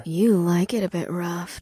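ordinary delivery, nothing marked

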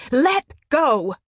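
forceful, furious cry; angry demand. A narrator's reading. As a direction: shout it as an order. She demands release in quarrel.

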